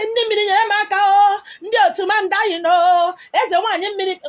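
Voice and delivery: high, loud pleading wail to the water spirits; mock prayer. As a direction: cry out like a desperate supplicant.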